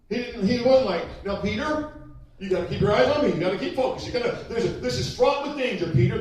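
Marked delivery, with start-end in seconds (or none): 1.2-6.2 fussy, rising worried warnings, loud at the end; anxious parent. Retold or imitated. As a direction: fret over him like a nervous parent. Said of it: "That would be me talking to my child".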